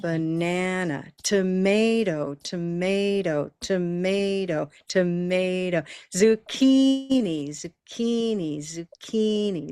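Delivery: "banana, tomato, zucchini, potato" on an even beat, middle syllables stretched; steady stressed-syllable drill. Shown as a demonstration. Teacher leading the class in practice, steady stretched stress. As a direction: repeat each word rhythmically, long stressed syllable and reduced schwas. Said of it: "just consistent".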